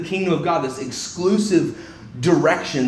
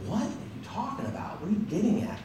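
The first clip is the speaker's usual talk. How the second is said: whispered, higher puzzled question; confused whisper; 7 semitones higher, 12 dB quieter than usual. Retold or imitated. Reader puzzled by Jesus's answer, whispering. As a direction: whisper it as if baffled to yourself. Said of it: "you might be like".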